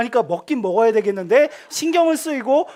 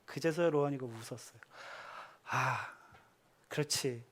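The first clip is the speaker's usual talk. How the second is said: usual speaking voice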